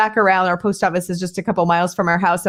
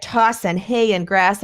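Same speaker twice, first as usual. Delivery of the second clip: normal talking voice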